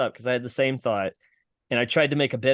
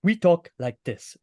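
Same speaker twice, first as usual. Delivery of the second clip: West African accent, higher and from the back of the throat; a quick West African accent demo, pitched up; a light, throaty West African accent; 4 semitones higher than usual. Shown as a demonstration. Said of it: "the West African accent".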